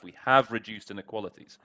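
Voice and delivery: "have" stressed, the rest flowing on. Shown as a demonstration. Teacher modelling the emphasized "have" for students. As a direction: push "have" in "We have reduced inequalities". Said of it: "just by putting that emphasis on the have".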